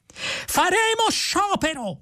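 very high, drawn-out cry; excitable protester's screech; yelled threat. A narrator's reading. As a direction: stretch the threat into a high strained yell. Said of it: "urlò Vrumfondel".

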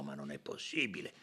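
very low, soft dismay; muted disbelief. A narrator's reading. Company director stunned by another connection, muted dismay. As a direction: murmur it in disbelief.

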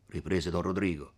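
ordinary delivery, nothing marked

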